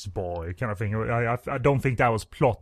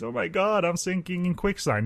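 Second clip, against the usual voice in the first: high, hammed-up damsel in distress; mock peril; 8 semitones higher than usual. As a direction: fake a panicked cry for help. Acting quicksand porn victim.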